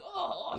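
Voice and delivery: high mock cry of being knocked down; exaggerated high "oh" as a punch lands; udawany jęk. Retold knock-down, boxer falls over.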